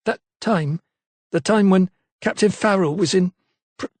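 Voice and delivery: put-on hesitant servant girl who stops short; halting maid. A narrator's reading. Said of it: "she could not bring herself".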